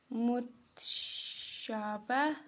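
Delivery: मुतशाबा stretched syllable by syllable, very slow; drawn out slowly. Shown as a demonstration. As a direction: spell out मुतशाबा slowly for notes. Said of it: "ये आप notebook पे लिखना". (मुतशाबा को बहुत धीरे खींचकर बोलना)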